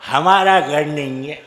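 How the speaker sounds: put-on low, dazed drunk voice; a beaten drunk confused about his house. Beaten husband tells his friend he's in the wrong house, low and dazed. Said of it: "दोस्त के पास जाके बोला".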